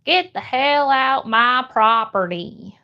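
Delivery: slow Southern drawl; thick Southern accent. Shown as a demonstration. Streamer showing a viewer the requested voice, thick Southern accent. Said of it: "Can you do a thick Southern accent?".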